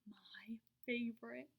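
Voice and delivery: slow delighted whisper. A narrator's reading. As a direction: savor it in a whisper. Delighted aside.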